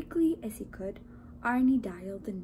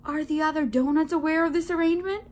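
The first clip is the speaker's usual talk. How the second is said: fast, loud, raised voice; aghast question; 6 semitones higher, 7 dB louder, faster than usual. A narrator's reading. Donut asks baker about the others, aghast. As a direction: fire it out in alarm.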